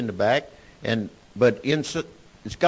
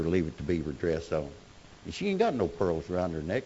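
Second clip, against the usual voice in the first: ordinary talk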